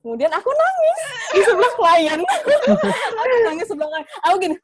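high, loud laughing delivery; laughing at your own embarrassing story; laughing while confessing. Real, not acted. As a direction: burst out laughing as you tell it. Laughing over own crying story.

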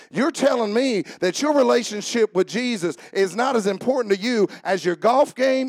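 usual speaking voice

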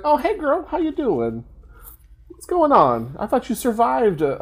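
put-on high, sing-song "hey girl" greeting; mock-friendly greeting. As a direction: greet an old pal in a mock-perky high voice. Imagined greeting to Amanda.

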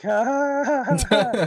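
high, loud mock cackle; gloating laugh. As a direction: laugh in secret triumph, hammed up. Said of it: "In the back of my head".

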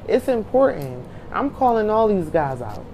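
ordinary talk

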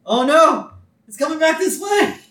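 very high, loud alarm; hammed-up fright; mock panic. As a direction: squeal as the boomerang returns. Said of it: "he's like".

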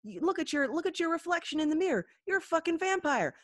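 hammed-up freak-out at a shocking discovery; horrified kid brother re-enactment. Acting out brother discovering vampire.

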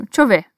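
"Deixa eu ver" squeezed to "tcho ver"; quick native slurring; reduced "deixa eu ver". Shown as a demonstration. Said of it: "Imagina um gringo ouvindo isso".

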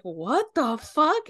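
slow, high, loud mock disbelief; shocked. Group reacting to Apollo's outburst, slow high mock shock.

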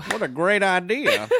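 lightly put-on voice, drawn out; a slow, hint-of-a-cat character voice; Scout. Friend speaking for Scout to approve the idea, lightly put-on slow voice. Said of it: "says Scout".

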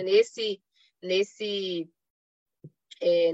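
normal talking voice

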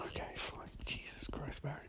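whispered, grudging aside; exasperated muttering. Retold or imitated. Harrison tired of Barry's complaints, exasperated whisper. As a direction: mutter it under your breath, fed up.

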